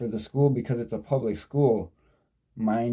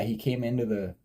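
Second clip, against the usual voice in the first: usual speaking voice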